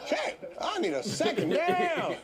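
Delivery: real laughter breaking into the words; laughing through it. Real, not acted. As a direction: laugh while you try to get the words out. Overwhelmed, laughing through his words.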